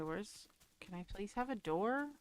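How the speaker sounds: usual speaking voice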